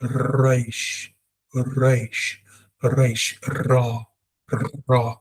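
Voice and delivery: "Reish" and "Ra" with long trilled R; like many R's in a row in "Reish", "Ra"; rolled R. Shown as a demonstration. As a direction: vibrate the tongue starting "Reish" and "Ra". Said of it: "a lot of R's in a row".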